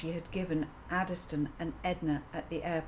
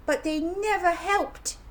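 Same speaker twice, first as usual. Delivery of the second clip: put-on child voice; 12 semitones higher, 7 dB louder than usual. A narrator's reading. Child voice complaining.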